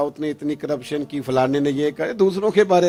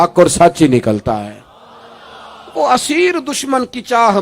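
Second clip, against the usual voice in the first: raised, higher, louder sermon voice; fiery preaching; 6 semitones higher, 8 dB louder than usual. Acted. Preacher rousing the congregation, fiery and loud. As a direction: preach it with full force to the crowd. Passionate preaching of Abu Sufyan's praise.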